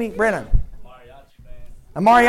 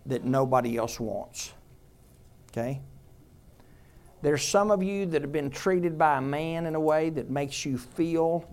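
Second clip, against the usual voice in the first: ordinary delivery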